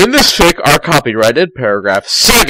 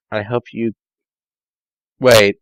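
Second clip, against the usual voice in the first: ordinary delivery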